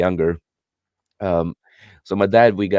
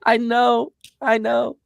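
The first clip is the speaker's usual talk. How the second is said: very high squeaky mock wail; hammed-up tearful protest in falsetto; mock crying; 3 dB louder than usual. Crying kid defends the anime to mocking dad, mock tearful. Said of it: "I started crying in certain scenes".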